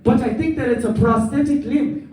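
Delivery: voicing Hector. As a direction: do Hector as a puzzled coworker. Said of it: "he's like".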